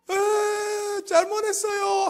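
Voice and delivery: put-on high, slow childish whimper of sorry; a small son sheepishly saying sorry; son's apology. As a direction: whine it like a little boy owning up. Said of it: "할 때가 있거든요".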